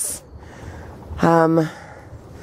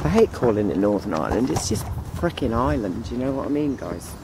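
quiet aside; 4 semitones lower, 7 dB quieter, faster than usual. Real, not acted. Quiet aside about Ireland.

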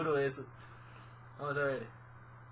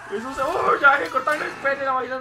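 horrified outburst; 6 semitones higher, 8 dB louder than usual. Real, not acted. Horrified at the scene.

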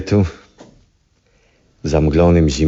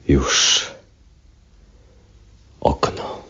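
whispered; 2 semitones lower, 4 dB quieter, much slower than usual. Acted. Old man alone at night.

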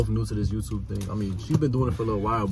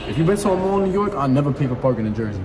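exasperated; 4 semitones higher, 6 dB louder than usual. Real, not acted. Frustrated at parking machine.

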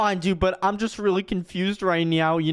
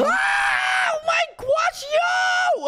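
high, panicked scream down the slide; terrified high yelp; much slower than usual. Acted. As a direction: shriek as you drop down the slide.

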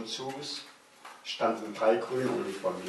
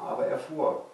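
plain reading voice

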